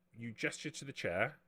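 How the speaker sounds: normal talking voice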